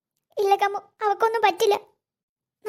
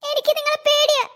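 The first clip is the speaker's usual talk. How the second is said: scared refusal; 5 semitones higher, 4 dB louder than usual. Acted. Frightened girl refuses her captor.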